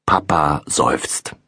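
ordinary delivery

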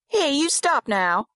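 put-on high-pitched little girl scolding; scolding child. A narrator's reading. Girl ordering the laughing boy to stop, scolding. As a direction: tell him off in a child's high voice.

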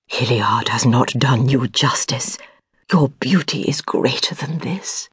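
hushed, unvoiced words of praise; breathless admiring whisper. A narrator's reading. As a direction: breathe the compliment, barely voiced.

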